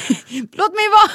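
high, loud protest; imitated child's yell. Overhugged daughter tells her sibling off, high imitated yell. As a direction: yell like a small girl fed up with hugs. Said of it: "Skrek hon och sa ifrån".

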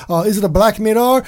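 usual speaking voice

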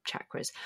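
"chakras" begun with CH, like "chocolate"; CH as spelled. Shown as a demonstration. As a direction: say "chakras" as CHAK-ruhz. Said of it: "it's spelled with a C H".

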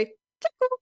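high, sing-song call to the cat. Shown as a demonstration. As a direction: coo the name up high. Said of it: "like that".

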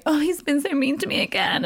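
high, lightly whiny self-pity; a hint of melodramatic whining; mock self-pity. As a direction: relish playing the victim. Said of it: "enjoying going".

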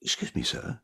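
hint of a bus conductress voice; lightly voiced conductress. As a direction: ask politely but suspiciously. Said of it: "the bus conductor says to him".

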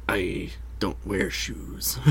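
slow, soft, grave; deadpan serious. Acted. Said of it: "Mister Krabs is like".

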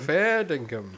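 Fair dinkum said plainly with an Aussie lilt; Australian slang. Shown as a demonstration. Australian host confirming the slang, matter-of-fact. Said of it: "That's what they say in Australia".

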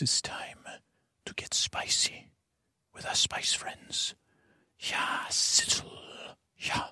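hushed breathy announcer whisper. Acted. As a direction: whisper it like a movie trailer host. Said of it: "our Hollywood announcer, Mister Antonio Banderas".

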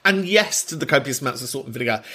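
ordinary delivery

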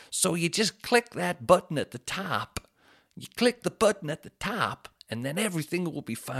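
sing-song YouTube tutorial parody; mock tutorial voice. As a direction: talk like an overly cheerful how-to video host.

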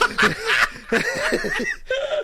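shrieking laugh; high laugh. Real, not acted. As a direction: laugh hard at it. Laughing hard.

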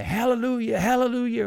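high, sung-out church hallelujahs; worked-up congregation. Acted. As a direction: cry it out like a revival crowd. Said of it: "minister works up the congregation".

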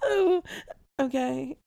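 high sigh coming down from a laugh fit. Real, not acted. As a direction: gather yourself, still tearful from laughing. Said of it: "I don't know why I'm laughing".

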